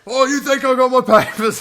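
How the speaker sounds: very high, loud British hooligan bluster; obnoxious British accent. Retold or imitated. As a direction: yell it in a hammed-up British accent. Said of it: "puts on this fucking most obnoxious".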